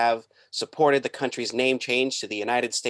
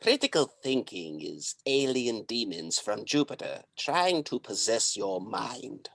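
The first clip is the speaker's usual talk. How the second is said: cult guru voice; 4 dB quieter than usual. Acted. Guru character.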